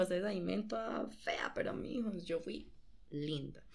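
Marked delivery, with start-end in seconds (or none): none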